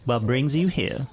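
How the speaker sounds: put-on neighbor lady in a West Virginia accent. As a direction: greet a caller in sing-song West Virginia speech. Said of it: "trying to do a West Virginia accent".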